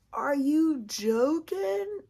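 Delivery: slow, high, stretched-out incredulous question; drawn-out disbelief. Retold or imitated. Telling viewers about the positive tests, drawn-out disbelief. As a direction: stretch it out, pitch climbing in disbelief.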